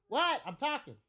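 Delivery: high "what?" called off to the side; interrupted, impatient. Real, not acted. Parent answering a child mid-story, impatient.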